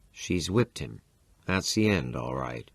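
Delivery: usual speaking voice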